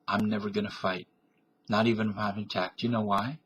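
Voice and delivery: ordinary talk, nothing marked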